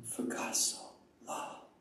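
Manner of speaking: barely audible whispered scripture; murmured prayer. Retold or imitated. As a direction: whisper it privately over each person. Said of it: "I said".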